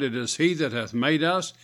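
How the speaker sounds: ordinary delivery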